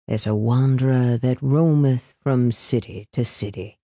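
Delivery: low, hushed recitation; whispered. A narrator's reading. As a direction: let the verse drop to a hush. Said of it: "he whispered".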